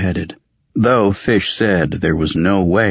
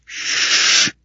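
long unvoiced shushing sound; hushing shh. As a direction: hush them with a drawn-out shh. Fish hushes the others.

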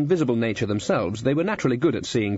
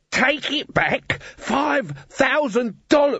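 high-pitched dwarf's squeal of outrage; blurting it out the moment the hand comes off; Glod's outburst; slower than usual. A narrator's reading. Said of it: "you're not to shout".

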